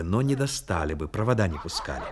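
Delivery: ordinary talk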